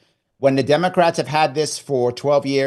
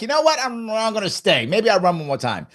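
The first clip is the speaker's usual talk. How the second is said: much higher on the opening, settling down as you decide to stay; defiant resolve; 8 semitones higher than usual. Retold or imitated. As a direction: start high and fired up, then calm into the decision. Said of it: "I got mad again".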